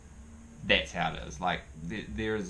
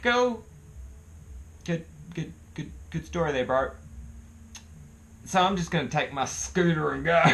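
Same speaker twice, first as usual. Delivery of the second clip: high-pitched, uneasy politeness; awkward fake approval; 10 semitones higher, 4 dB louder than usual. New scooter renter answering a crash story, awkwardly upbeat. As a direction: stall high and halting, then make an exit.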